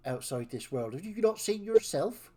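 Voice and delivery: scornful West Country villain; mock them in a West Country sneer. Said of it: "doing a villain voice in West Country".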